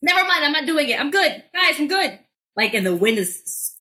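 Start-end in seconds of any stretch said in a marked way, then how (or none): 0.0-2.2 high-pitched mock panic at normal volume; retold panicky refusal; freaking out. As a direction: act out nervously backing out, voice pitched up. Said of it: "like, freaking out".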